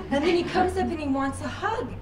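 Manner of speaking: ordinary talk, nothing marked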